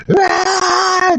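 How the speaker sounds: high shouted shriek; mock Karen shriek. Karen trying to outshout fireworks, high mock shriek. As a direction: let out an outraged screech. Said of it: "Trying to be louder than the fireworks".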